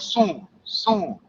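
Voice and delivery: soft, murmured soon soon from the boss; boss brushing off. Retold or imitated. Boss brushing off his driver, murmured softly. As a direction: hush it like a busy boss putting him off. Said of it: "He's like".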